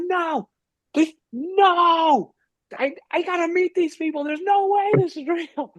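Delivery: high, whining mock plea; losing it. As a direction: beg in hammed-up desperation. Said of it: "I'm absolutely losing it".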